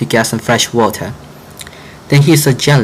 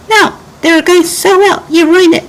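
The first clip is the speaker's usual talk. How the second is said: put-on much higher kid voice, upset; little brother yelling; 4 dB louder than usual. Youngest child yelling at frog over dominoes, high kid voice. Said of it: "Birdie yelled".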